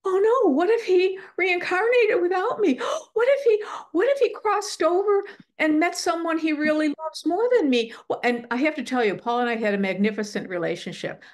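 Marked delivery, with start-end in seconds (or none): 0.1-7.9 high-pitched re-enacted panic with gasps; freaked out. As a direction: spiral into hammed-up, tearful worry. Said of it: "I freaked out thinking".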